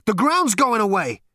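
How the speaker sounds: loud, very high, aghast cry; gasped shock. A narrator's reading. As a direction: cry out in astonishment. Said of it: "he gasped".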